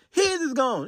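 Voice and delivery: his is gone, his hit hard and high; stress on his. Real, not acted. Correcting which friend lost the car, stressing his. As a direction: lean on his to correct them. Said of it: "Not Eric's".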